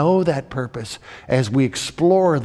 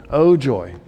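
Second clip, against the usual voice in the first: dry sarcastic oh joy. Pretend.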